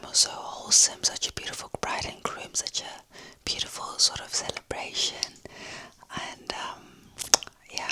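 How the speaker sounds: hushed whisper so nobody wakes. Real, not acted. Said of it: "I have to whisper because I think there's other people".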